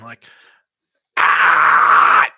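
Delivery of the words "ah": loud rasping fry scream; fry scream demo; metal scream. Shown as a demonstration. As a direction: tear it out of the throat. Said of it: "emphasize on the words in, like, a scream".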